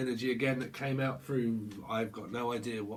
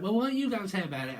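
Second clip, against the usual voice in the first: mock challenge; 8 semitones higher, faster than usual. Repeated higher, faster.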